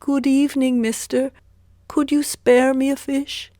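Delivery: high, loud begging voice; poor man asking. A narrator's reading. Poor man asks Zheng for a fish, high and pleading. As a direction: ask for a fish hopefully. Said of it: "he said".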